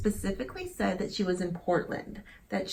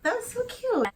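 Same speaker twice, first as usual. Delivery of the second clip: gushing. Real, not acted.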